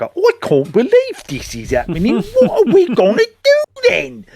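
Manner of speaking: high, strained imitation of a posh English accent; mock posh English accent. Podcaster mocking a game character's English accent, high and panicky. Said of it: "Rachel in particular was like".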